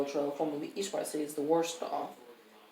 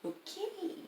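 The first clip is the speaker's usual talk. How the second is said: very soft, high little okay; under the breath; 11 dB quieter than usual. Acted. Character answering privately beside the woman, soft and high under the breath. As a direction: slip it out so she won't notice. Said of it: "Under his breath, quietly enough that she doesn't notice".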